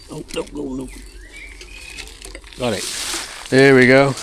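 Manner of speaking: strained gasps mid-effort; effortful, reaching for the can; straining hard. Real, not acted. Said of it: "Sorry about the straining and stressing".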